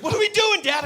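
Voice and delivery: put-on high, loud child's cry; mock a kid yelling in confusion; bewildered kids. Kids to dad being lifted through car window, high loud protest. Kids question dad's odd game.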